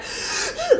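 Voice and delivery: a sharp, high gasp for breath like sobbing; imitated sob gasp; mock crying gasp. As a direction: gasp as if choking on tears. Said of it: "like, you know, can't breathe".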